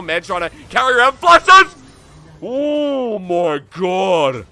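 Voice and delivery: a big, high, loud shout that stretches into a slow oh my god; excited shout. Real, not acted. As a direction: explode at the clutch play. Huge play erupts on screen.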